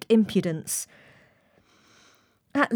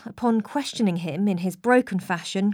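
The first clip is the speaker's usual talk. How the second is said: ordinary delivery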